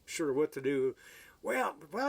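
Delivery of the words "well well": higher, coaxing salesman's opener; eager salesman. Retold or imitated. Salesman coaxing the hesitant customer, high coaxing voice. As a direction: coax the customer in, pitched up. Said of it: "he said".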